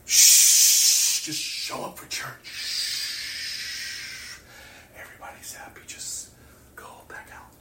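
hushed, shushing whisper; mock hushing whisper. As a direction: shush them like a church usher. Mocking churches hushing people.

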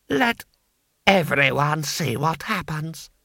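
slow, deliberate lordly proclamation; menacing lord. A narrator's reading. Lord to the silent crowd before striking, menacing.

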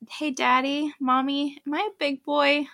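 put-on proud little kid checking with his parents; little boy voice. Voicing her little son.

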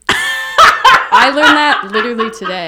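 laughing loudly, slowly through the words; can't help laughing at it. Real, not acted. Telling her cohost a shocking fact, laughing.

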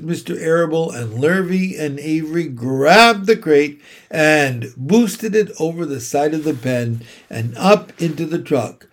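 usual speaking voice

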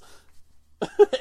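a high, loud, breathy laugh; burst of laughter. Real, not acted. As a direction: laugh out at your own joke. Laughing at own joke.

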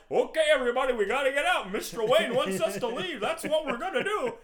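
high, loud, hammed-up announcement; rally the office like a cheerful dimwit; oblivious office guy. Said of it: "And the guy's like".

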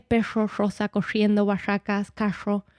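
"perro, rosa, corriendo, barracas, carro" con cada R como sh. Shown as a demonstration. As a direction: pronuncia "perro" como "pesho", cada R arrastrada como sh. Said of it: "La R se pronuncia como sh". (todas las R como sh, acento de su ciudad)

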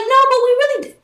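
very high, frantic; panicked friend. Retold or imitated. Friend answering that they really died, very high and frantic. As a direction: squeal it like a terrified friend.

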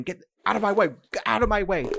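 high, loud panicked shout; screaming. Acted. Fleeing stranger shoves players aside, loud panicked yell. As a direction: shove past yelling in terror. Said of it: "as they scream".

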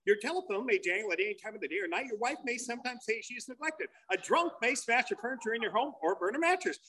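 fast, soft disclaimer read; fine-print voice. Acted. Comic reading the big book's fine print, rapid disclaimer voice. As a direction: rattle it off like ad small print. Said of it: "in a different voice".

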